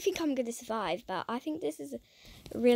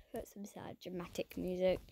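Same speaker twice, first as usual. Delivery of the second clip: whisper; 6 semitones lower, 13 dB quieter than usual. Real, not acted.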